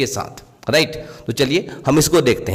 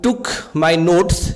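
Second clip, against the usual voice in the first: very slow, word by word, slightly raised pitch; slow example reading; much slower than usual. Shown as a demonstration. Teacher giving students an English example sentence, slow and clear. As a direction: read it out like a dictation. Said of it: "teacher reads English example".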